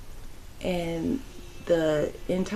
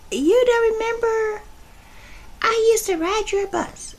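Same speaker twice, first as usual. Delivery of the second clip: put-on young clerk; 12 semitones higher than usual. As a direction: play the kid reminding her. Kid voiced in the story.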